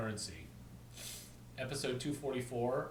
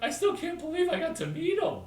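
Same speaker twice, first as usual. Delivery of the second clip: high, loud starstruck gush; starstruck fan; 8 dB louder than usual. Retold or imitated. Fan telling Larry he met his hero. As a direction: gush like you just met your hero. Fan gushing about meeting Nick.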